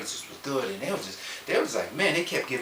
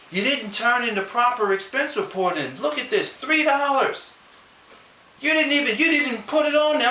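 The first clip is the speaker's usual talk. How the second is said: accusing boss voice; 10 semitones higher, 3 dB louder than usual. Retold or imitated.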